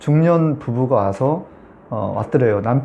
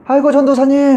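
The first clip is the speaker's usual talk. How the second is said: high, louder happy call; excited greeting; 11 semitones higher, 4 dB louder than usual. Retold or imitated. Freed woman coming back in to greet the preacher, high happy call. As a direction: call out the preacher's name with delight.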